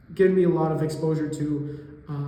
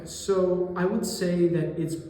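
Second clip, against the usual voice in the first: usual speaking voice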